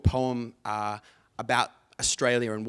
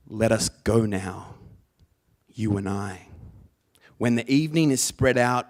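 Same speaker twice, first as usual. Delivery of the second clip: slammy beat poetry; 6 dB louder than usual. Shown as a demonstration. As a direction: punch each phrase like a slam poet. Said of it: "really slammy kind of beat poetry style".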